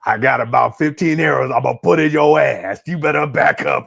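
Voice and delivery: put-on loud threatening old lady; loud granny threat. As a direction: bark it like a fed-up granny. Granny archer threatens with arrows.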